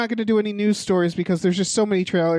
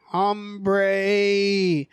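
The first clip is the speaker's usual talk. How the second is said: grizzled voice. Shown as a demonstration. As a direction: growl the name out dry and calm.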